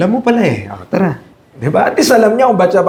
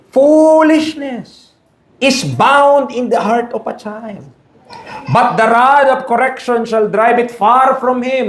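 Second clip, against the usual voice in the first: slow, weighty verse read from the pulpit; solemn scripture reading; 4 semitones higher, slower than usual. Acted. Preacher reading Proverbs to the congregation, slow solemn recitation. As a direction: draw each word out like a proclamation.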